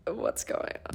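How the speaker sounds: whispered in a daze; dazed whisper. Retold or imitated. Fiancée recalling the proposal shock, dazed whisper. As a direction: breathe it out, stunned. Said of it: "you're just like".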